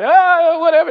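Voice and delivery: high, loud dismissive scoff, retold; mock-amused disbelief; laughing it off. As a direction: brush off an obvious joke loudly. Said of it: "I laughed 'cause I thought he was joking".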